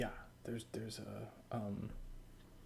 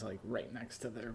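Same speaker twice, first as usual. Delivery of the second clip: normal talking voice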